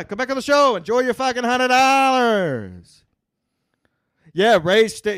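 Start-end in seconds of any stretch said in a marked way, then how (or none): 0.0-3.0 high, loud sneering mock voice; mocking whiny impression. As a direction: mimic him in a loud, high, sneering whine. Mocking Stancil, loud, pitch shifted.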